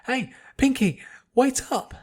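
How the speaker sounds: loud high call across the street; calling out to Pinkie as she dashes ahead; shouting. Acted. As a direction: yell after a friend running off. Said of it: "she shouted".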